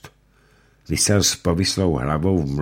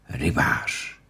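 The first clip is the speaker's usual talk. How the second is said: whispered moan of the word; groaning whisper; 2 semitones lower than usual. Acted. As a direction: groan it half-conscious. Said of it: "sténal Ulenšpígl".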